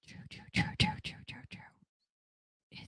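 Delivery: true repeated, very high, dying into a whisper; fading echo. Pretend. Echoing her own point, fading whisper. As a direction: let the word echo away to nothing. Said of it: "repeating true fading to whisper".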